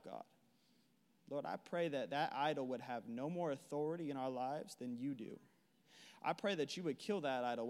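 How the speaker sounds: soft, low intimate prayer. Real, not acted. Preacher praying softly over the congregation, low and hushed. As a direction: pray quietly over the room. Prays quietly over the room.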